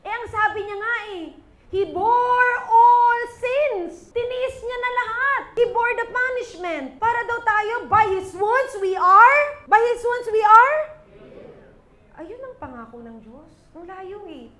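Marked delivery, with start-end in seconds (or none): 1.7-4.1 very slow, drawn-out high shout; preacher thundering a scripture line to the church; preaching proclamation. Acted. 7.9-9.7 slow, louder line left hanging on a rise; preacher leading the church into the verse; building proclamation. Acted. Preacher starts scripture for response. 9.7-10.8 higher, louder, rising prompt for the crowd; preacher pressing the congregation to answer; calling for response. Acted. Repeats, urging congregation to answer.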